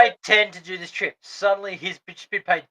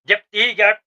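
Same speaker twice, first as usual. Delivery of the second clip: breezy quick handover; 4 semitones higher, 7 dB louder than usual. Retold or imitated. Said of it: "pulled out real quick to go".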